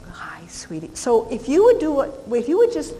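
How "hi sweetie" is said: breathy soft greeting to dog; soft whisper. Shown as a demonstration.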